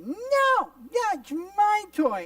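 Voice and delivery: very high, loud toy-grabber; bratty child. Acted. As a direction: shout it like a possessive little kid. Acted child grabbing a toy away.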